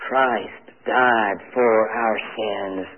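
low, very slow, "Christ", "died", "for", "our", "sins" each weighted; stress on every word. Shown as a demonstration. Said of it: "every word is deserving of emphasis".

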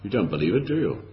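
ordinary talk

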